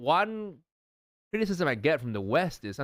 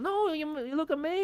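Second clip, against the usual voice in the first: high, far falsetto gushing; mock fake compliment. Mocking friends in person, gushing fake praise. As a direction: coo over-sweet flattery. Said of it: "But then in the, in person".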